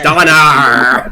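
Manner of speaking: loud West Country pirate; pirate accent. Retold or imitated. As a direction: bellow it like a pirate. Repeating the Donna impression.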